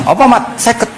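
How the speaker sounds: high-pitched objection; protesting. Acted. Moses objecting to fifty prayers for the people, protesting. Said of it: "Bareng tok Nabi Musa bantah".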